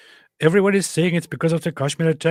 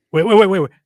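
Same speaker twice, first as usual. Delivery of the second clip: loud, high burst of waits; excited wait; 7 semitones higher, 11 dB louder, faster than usual. Real, not acted. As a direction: stop everyone, loud and excited. Excited interruption.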